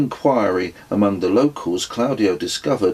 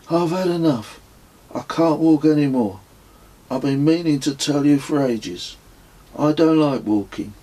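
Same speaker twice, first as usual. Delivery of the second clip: higher whiny voice of a fed-up walker; sulky refusal; 7 semitones higher than usual. Acted. Kez complaining to the narrator he won't walk another step, in a higher whiny voice. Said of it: "announced that he would go not a step further".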